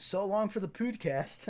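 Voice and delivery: high-pitched joking send-off; mock farewell. Joking to co-host that the show is over, mock farewell. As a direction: bid a hammed-up goodbye to the show.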